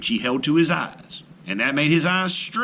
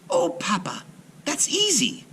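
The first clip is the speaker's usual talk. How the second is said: put-on much higher, light, amused young girl; laughing girl's voice; 9 semitones higher than usual. A narrator's reading. Aisha laughing off her father's worry, high amused girl voice. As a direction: brush it off brightly like a clever daughter. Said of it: "laughed Aisha".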